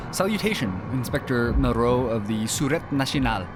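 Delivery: high, loud theatrical reading; dramatic letter reading. Acted. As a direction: read the letter like a stage part. Reads the in-game letter aloud.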